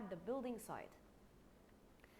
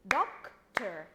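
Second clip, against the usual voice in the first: "Doctor" in two loud, separate beats; clapped-out syllables; 3 semitones lower, 9 dB louder than usual. Shown as a demonstration. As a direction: clap out "Doctor" loudly, one syllable at a time. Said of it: "Let's clap the word together".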